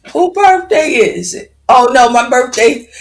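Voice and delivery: light up at the name and call it out; excited greeting. Real, not acted.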